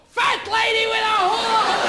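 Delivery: mock yell of someone who just stubbed a toe; pained exclamation. Midnight toe-stubber cursing, mock pained yell. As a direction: cry it out like a curse.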